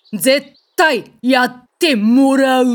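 slow, loud, each syllable of 絶対やってもらう pressed out; menacing insistence. Acted. Athena cornering Perseus at Medusa's lair, slow menacing insistence. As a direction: lean in and leave no way out.

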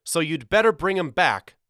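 put-on high, loud boyish threat; heated ultimatum; threatening. A narrator's reading. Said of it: "threatened Walter".

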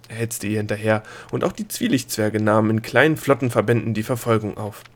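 ordinary delivery, nothing marked

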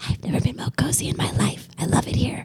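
whispered blissful contentment; cozy whisper. Retold or imitated. Teen imitating herself whispering cozy in bed. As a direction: whisper it snug under the covers.

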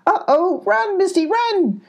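very high goat alarm; panicked cry. A narrator's reading. Goat warning Misty to flee, high panicked cry. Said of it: "cried the goat".

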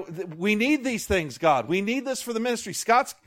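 ordinary talk, nothing marked

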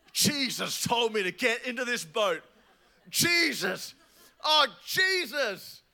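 loud, high laughing panic; panicked cry. Retold or imitated. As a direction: cry out half-laughing in terror. Panicked disciples in storm.

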